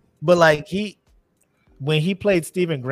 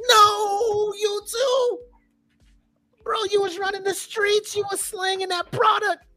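very high-pitched, wailing protest; mock dismayed disbelief; slower than usual. Fan retells learning the actor is British, high mock dismay. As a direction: plead in a squeaky, betrayed voice.

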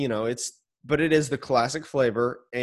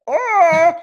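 startled cry; 14 semitones higher, 8 dB louder than usual. Real, not acted. Cat startles him.

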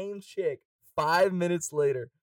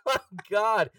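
high, loud laughing outburst; laughing disbelief; 9 semitones higher, 5 dB louder, much slower than usual. Real, not acted. Laughing disbelief retelling chaos.

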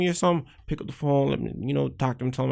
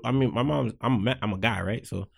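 ordinary talk, nothing marked

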